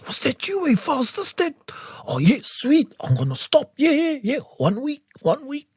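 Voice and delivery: playful high-pitched pretend voice; high mock voice. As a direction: ham up a cheeky bargain. Bargaining with the voice.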